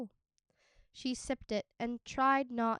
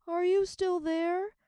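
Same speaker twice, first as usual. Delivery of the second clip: plaintive; 7 semitones higher, 7 dB louder than usual. Acted. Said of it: "the voice asked plaintively".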